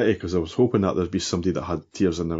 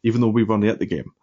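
usual speaking voice

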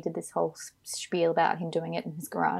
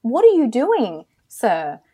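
louder, higher, incredulous telling-off; exasperated scolding; 4 semitones higher, 5 dB louder than usual. Acted. Co-host berating an absent actor over his award nomination, exasperated. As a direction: scold in loud disbelief, pitch swooping up.

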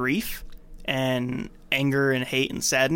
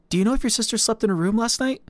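lightly voiced dad; 7 semitones higher than usual. Voices the dad asking Peter.

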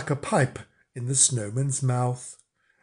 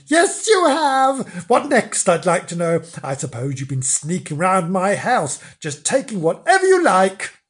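angry rant; 5 semitones higher, 4 dB louder than usual. Acted. Flange ranting at snowman.

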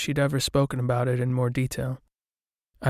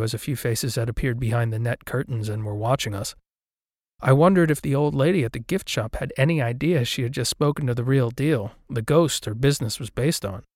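ordinary delivery, nothing marked

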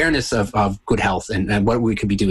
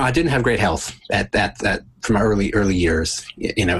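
ordinary talk

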